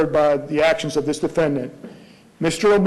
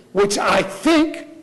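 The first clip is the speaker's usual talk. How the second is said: slow right down and lift the word think; quoting the lawyer, drawing out think; stress on think; much slower than usual. Shown as a demonstration. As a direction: think stretched slowly with a rising tune. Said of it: "emphasize think".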